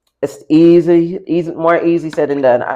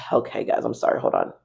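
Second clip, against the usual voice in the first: whispering; 5 semitones lower, 8 dB quieter, faster than usual. Real, not acted. Composing herself off-mic aside.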